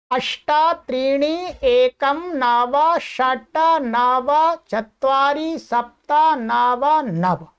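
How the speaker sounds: measured, word-by-word number recital; slow Sanskrit digits. Shown as a demonstration. Teacher gives his number in Sanskrit, slow and deliberate. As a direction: spell out the number slowly, digit by digit. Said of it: "Sanskrit में बोलेंगे इसको तो कैसे बोलेंगे".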